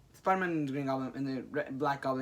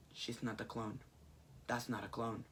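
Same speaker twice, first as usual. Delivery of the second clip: quiet reveal; 5 semitones lower, 13 dB quieter than usual. Retold or imitated. Jackal's quiet reveal retold.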